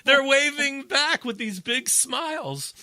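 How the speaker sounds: high, loud delighted telling; gleeful punchline. Real, not acted. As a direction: crow it over the laughter. Gleeful punchline amid shared laughter.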